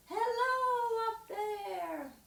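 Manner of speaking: high, drawn-out sung-out call; very slow, high falsetto greeting. Shown as a demonstration. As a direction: call up to someone very tall. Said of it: "she's very tall".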